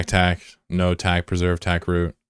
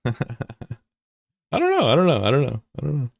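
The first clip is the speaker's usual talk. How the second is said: laughing; 7 semitones higher than usual. Real, not acted. Laughing, repeated evasion.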